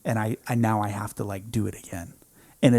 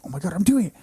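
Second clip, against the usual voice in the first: elated cry; 9 semitones higher, 4 dB louder, faster than usual. Retold or imitated. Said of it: "I was like".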